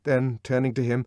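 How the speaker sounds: ordinary delivery, nothing marked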